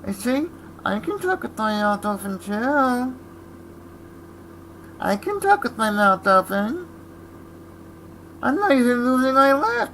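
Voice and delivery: high, strained puppet-like voice; ventriloquist voice. Pretend. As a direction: talk like a smug ventriloquist's dummy. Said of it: "The ventriloquist".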